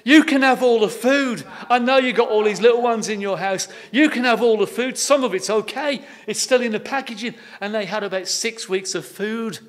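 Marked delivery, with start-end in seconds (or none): 0.0-7.4 higher, loud eager offer; loud, excited driver at the door; eager lorry driver. Retold or imitated. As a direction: call out the good news generously. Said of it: "And he said".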